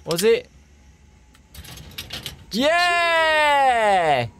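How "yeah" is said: high excited cheer; triumphant shout. Real, not acted. Code works.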